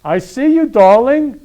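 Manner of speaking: campy drag queen. Acted. Host gushes to costumed guest.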